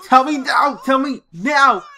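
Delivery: mock cop yelling a demand; bad cop shout. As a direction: bark the order at the suspect. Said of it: "we're gonna play bad cop".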